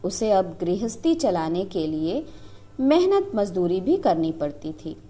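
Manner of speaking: plain reading voice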